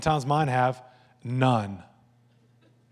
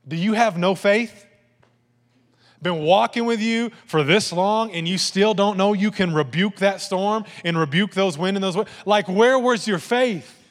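higher, louder exasperated scolding; angry, sarcastic; 5 semitones higher, 6 dB louder than usual. Acted. Angry Jesus scolding disciples he has walked with, loud and exasperated. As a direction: tell them off in disbelief. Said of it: "he was angry? ... sarcasm".

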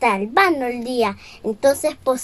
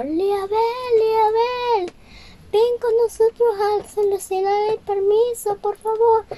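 ratoncitos llamando; 8 semitones higher than usual. Acted.

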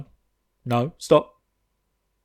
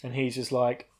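ordinary delivery

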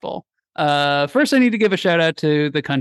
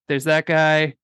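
ordinary talk

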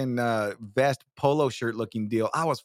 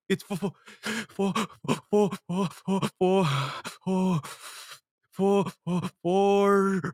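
sobbing stutter, higher voice; mock crying; 4 semitones higher, slower than usual. Crying kid answering the teacher's question, mock sobbing stammer. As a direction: suck air and stick on the first sound. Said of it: "you're sucking air, you're crying".

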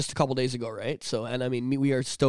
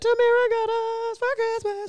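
squeaky voice; slower than usual. Pretend. Sudden high put-on voice.

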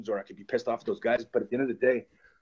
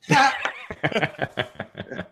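loud laugh, pitched up; long real laugh; 4 semitones higher, 5 dB louder than usual. Real, not acted. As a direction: burst out laughing at the line. Laughs at the cray cray line.

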